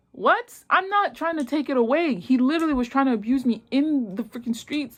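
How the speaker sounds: high-pitched mock outrage; retold indignant protest. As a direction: act out protesting in disbelief. Acting her protest to police.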